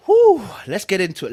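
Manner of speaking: loud hyped kickoff; excited. Real, not acted. Reader restarting the reading for viewers, hyped. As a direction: pump them up to start.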